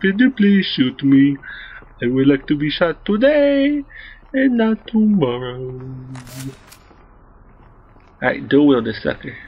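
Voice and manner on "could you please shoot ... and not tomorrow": slow, drawn-out mock request; higher, exaggeratedly patient sarcasm; mock polite. Player to enemies just standing still, mock polite.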